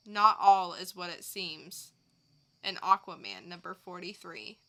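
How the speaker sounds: loud dramatic trailer read; promo read. Acted. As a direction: boom it like a movie trailer. Co-host reads comic blurbs.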